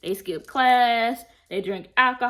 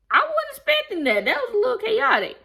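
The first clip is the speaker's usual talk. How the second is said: shocked squeal; 5 dB louder than usual. Retold or imitated. Shocked by the fake-shooting proposal.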